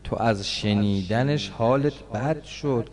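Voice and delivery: a sermon sliding into chanted lament; mourning recital. Acted.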